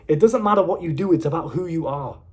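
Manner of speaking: normal talking voice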